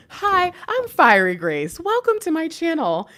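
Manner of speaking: high, loud, slow bubbly host greeting; cheesy intro voice. Acted. Greeting new viewers, high perky host voice. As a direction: play the perky channel host.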